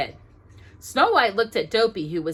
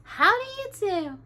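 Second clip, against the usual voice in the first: high, sweet greeting; high polite princess voice; 13 semitones higher than usual. A narrator's reading. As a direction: greet them prettily. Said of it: "smiled prettily and said".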